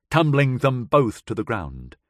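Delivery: ordinary delivery, nothing marked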